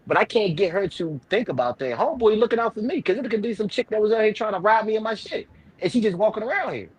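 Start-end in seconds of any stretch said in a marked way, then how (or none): none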